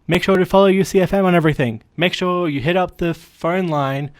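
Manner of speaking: DJ-style shout-out; radio plug. Real, not acted. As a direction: rattle off the station plug.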